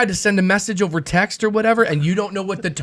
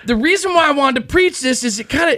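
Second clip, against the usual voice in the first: raised, much higher voice, hammed-up debating; play-acting an argument, pushing back hard; mock heated argument; 9 semitones higher than usual. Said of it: "let's reenact".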